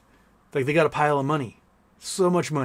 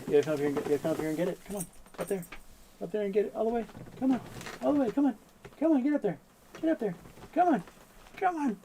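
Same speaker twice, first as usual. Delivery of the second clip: much higher, cooing call to the cat; coaxing pet voice; 7 semitones higher, 5 dB quieter, faster than usual. Real, not acted. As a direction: lure the cat up with a sing-song coax. Coaxing his cat onto a perch.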